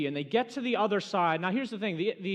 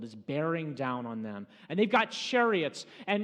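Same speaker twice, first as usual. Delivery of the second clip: ordinary talk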